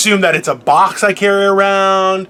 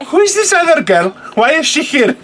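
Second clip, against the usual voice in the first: lightly voiced mother, higher-pitched; mock suspicious mom; 8 semitones higher than usual. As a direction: imitate a mom questioning a newcomer. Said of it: "my mom will be like".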